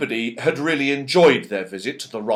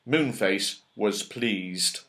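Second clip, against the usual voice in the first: ordinary delivery, nothing marked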